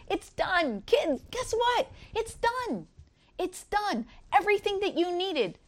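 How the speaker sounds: high, triumphant announcement that it's all done; cry of victory. Retold or imitated. Jesus to his children, a cry of victory. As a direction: call it out like shouting good news to the kids. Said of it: "it was a cry of victory".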